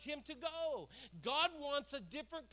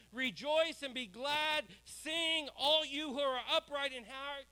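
rousing scripture; 4 semitones higher, 4 dB louder than usual. Acted. Repeating psalm.

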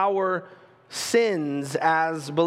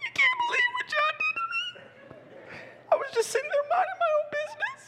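high whiny mock sobbing, far higher than his voice; fake crying; 5 dB quieter, faster than usual. Sister fake-crying to Daddy about John, high mock sobs. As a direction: ham up a tattling little sister's fake tears. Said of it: "she would be like, laughs".

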